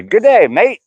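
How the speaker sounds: high, bright-pitched mock "Good day, mate"; mock Aussie greeting. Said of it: "You'd look at me and say".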